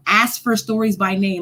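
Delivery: ordinary delivery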